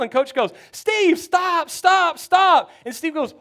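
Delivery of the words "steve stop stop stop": higher, louder urgent calling out; alarmed shout. Retold or imitated. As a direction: yell to stop him in his tracks. Coach stops Steve running out.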